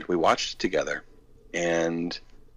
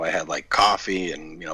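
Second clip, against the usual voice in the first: normal talking voice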